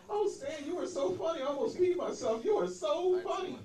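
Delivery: put-on high, gushing fan girl voice. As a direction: squeal the praise like a starstruck fan. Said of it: "a girl came up to me".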